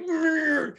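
high-pitched held-in noise; strained squeal. Retold or imitated. Patient holding pillow to his guts, straining not to laugh. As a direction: imitate straining to keep laughter in.

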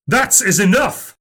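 high, angry yell. A narrator's reading. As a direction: put a stop to it with a yell. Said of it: "yelled Dad".

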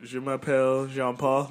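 a mock French intro, spoken proudly; French phrase on request. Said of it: "Say something".

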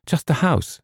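put-on high, sweet-girl character voice; girl's voice. A narrator's reading. Girl visitor answers James about the house, girlishly.

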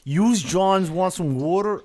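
slow, put-on Philadelphia accent; hammed-up Philadelphia accent; bad Philly accent. Shown as a demonstration. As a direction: try a Philly accent and miss. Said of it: "I can't really do a Philly accent".

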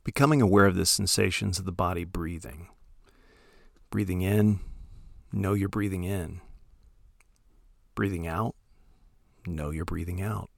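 soft, unhurried meditation voice with long pauses; calm, hushed instruction, letting each phrase trail into silence; guided meditation. Acted. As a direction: gently guide listeners into settling, phrase by phrase.

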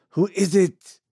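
high, loud call from another room; old man calling out. Acted. Sick old man calls out again to the door. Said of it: "came the old voice again".

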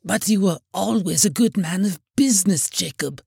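faltering. A narrator's reading. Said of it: "faltered Scrooge".

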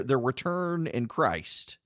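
ordinary delivery

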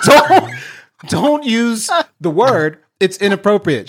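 high, loud exasperated dad; retold flustered scolding. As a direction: scold through laughter, slowing down to plead. Retelling dad's flustered scolding of child.

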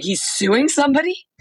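high, rising incredulous question; imitated disbelief. Retelling a rental clerk's reaction to trial news, mock disbelief. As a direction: act out someone baffled by the news.

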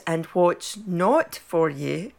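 slow, lightly put-on posh Surbiton voice; a mild send-up of a Surbiton accent. Host gives the Surbiton version of the saying, mock posh accent. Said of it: "the Surbiton version is".

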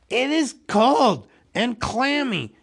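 high, loud cry of disgust; disgusted recoil. Acted. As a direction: exclaim it as if recoiling. Bilbo recoils from the fish.

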